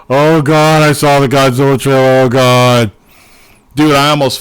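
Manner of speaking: loud, gushing fan outburst; real fanboy raving at full volume; excited outburst. Real, not acted. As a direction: burst out loudly, overwhelmed with excitement. Gushing over the Godzilla trailer.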